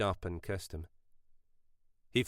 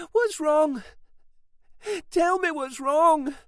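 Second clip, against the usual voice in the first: high, slow pleading, louder, near tears; starting to cry; 7 dB louder, slower than usual. A narrator's reading. Sister crying as the men fight, pleading. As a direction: beg to know what's wrong through tears. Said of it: "Mackenzie started to cry".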